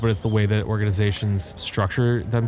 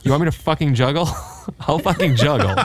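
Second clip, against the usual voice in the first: laughing mock outrage; 6 semitones higher than usual. Mock outrage at biscuit question.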